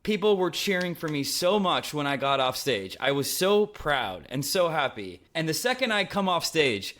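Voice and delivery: ordinary talk, nothing marked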